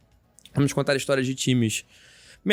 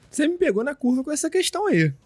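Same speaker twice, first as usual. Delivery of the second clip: surprised; 11 semitones higher than usual. Real, not acted. Caught off guard by question.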